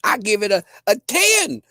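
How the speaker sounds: high, loud delighted scoring; delighted rating. Real, not acted. Friend rating the joke, high delighted cheer. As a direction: cheer it out like a judge loving the joke.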